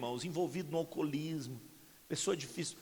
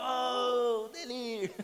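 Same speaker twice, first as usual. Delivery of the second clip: imitating a father hollering across the house; mock yell of a drunk father; retold drunken yell; 8 semitones higher, much slower than usual. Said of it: "ele chamava minha mãe e gritava".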